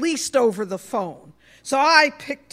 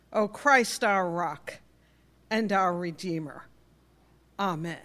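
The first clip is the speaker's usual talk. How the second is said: prayer; 2 semitones lower than usual. Acted. Opening prayer before sermon.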